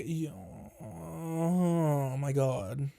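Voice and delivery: very slow, soft drawn-out groan; groaning dismay. Retold or imitated. Told they must stay for rides, long soft groan. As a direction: drag it out softly in weary dismay. Retold dismay at staying longer.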